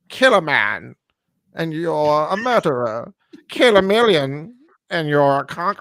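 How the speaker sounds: a hammed-up imitation of Lithgow's villain accent; mock Lithgow accent. Mocking Lithgow's villain line to the co-host, in a mock accent. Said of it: "his accent".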